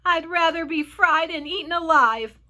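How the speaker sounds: loud, high wail of dread. A narrator's reading. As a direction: wail it out dramatically. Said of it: "wailed the old green grasshopper".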